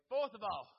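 normal talking voice

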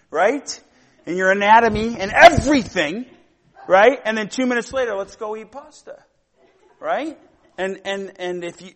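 loud, high, heated preaching rant; sermon at full blast; fired-up preaching. Real, not acted. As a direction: preach it full force, laughing through it. Heated preaching about hot tempers.

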